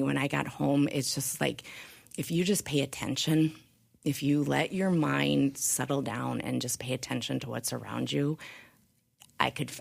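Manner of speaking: ordinary delivery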